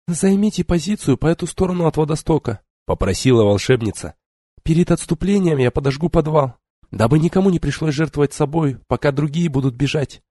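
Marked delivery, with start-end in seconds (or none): 0.1-2.6 put-on high, brisk young woman; young sorceress voice. Tiari voice again. 4.6-10.2 put-on high, brisk young woman; young sorceress voice. Tiari voice again.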